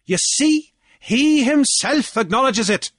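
high, loud gloating declaration; assured triumph. A narrator's reading. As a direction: proclaim a won argument. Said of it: "Assured triumph was in Deasy's voice".

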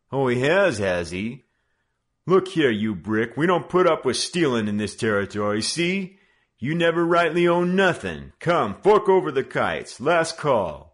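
loud, high bullying orders; gang leader. Acted. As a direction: lay down the law on the street.

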